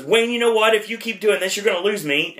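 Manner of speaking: put-on frustrated girlfriend. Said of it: "and she says".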